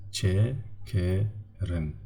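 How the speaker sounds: "Çekerin" drawn out very slowly, syllable by syllable; slow model word for students; slow demonstration. Shown as a demonstration. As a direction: stretch out "Çekerin" so each syllable is heard. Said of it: "So when we divide".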